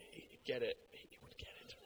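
dropped to a near-whispered threat; muttered threat. Retold or imitated. Husband muttering about the soldier staring at his wife, hushed and menacing. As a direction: mutter it under your breath, half joking.

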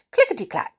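high, crisp clickety-clack; clicking needles. A narrator's reading. Narrator imitating the knitting needles, high and crisp. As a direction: tap it out like needles.

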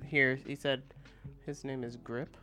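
ordinary talk, nothing marked

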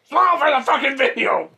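high, loud imitation of a yelling father; exaggerated angry stage-parent shout; mock yelling dad. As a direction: order a child to smile, hammed-up. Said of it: "he's, like, yelling at her".

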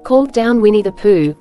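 usual speaking voice

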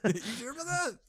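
high, laughing echo of the joke. Real, not acted. Cohost echoing the punchline, laughing high. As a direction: repeat it while cracking up.

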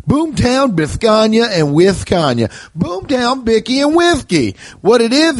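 put-on sponsor names twisted into a Philadelphia accent, high and hammy; mock Philadelphia accent. Comic reading his sponsor ad in a joking Philadelphia accent. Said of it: "I'm not gonna do a Philadelphia accent".

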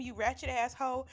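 usual speaking voice

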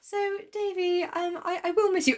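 high, halting, tearful mother; hammed-up choked-up goodbye; mock emotional goodbye. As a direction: falter through a high-pitched farewell. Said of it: "don't be so emotional".

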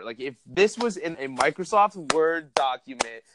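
ordinary delivery

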